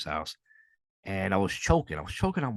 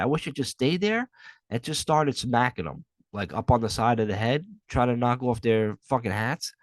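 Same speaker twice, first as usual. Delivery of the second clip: ordinary delivery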